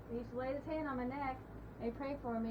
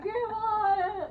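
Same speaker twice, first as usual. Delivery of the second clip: retold wail; 11 semitones higher, 11 dB louder than usual. Child calling in pain, retold.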